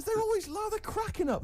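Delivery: squeaky high disbelief; high incredulous. Retold or imitated. Mocking the audience who laugh at the show.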